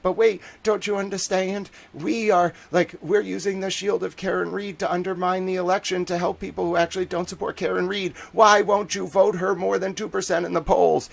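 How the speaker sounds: high, whiny protest of a put-on activist; mock indignant pleading. Mocking planted candidates' plea.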